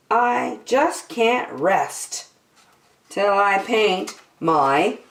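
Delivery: usual speaking voice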